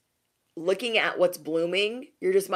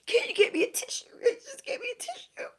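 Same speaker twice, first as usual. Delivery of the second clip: very high squeaky mock-tearful plea; fake crying. People with crocodile tears wanting a tissue, fake crying. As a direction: beg through badly faked sobs. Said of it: "when people are crying and then, like, no tears come out".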